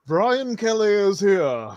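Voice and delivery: mock colonial announcement; town crier. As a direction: proclaim it like a powdered-wig herald. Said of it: "Just announcing".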